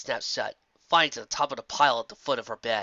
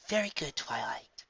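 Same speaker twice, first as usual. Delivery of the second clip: slow, hushed wise princess; soft Celestia voice; 7 dB quieter than usual. A narrator's reading. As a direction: speak softly like an old mentor. Celestia praises her pupil.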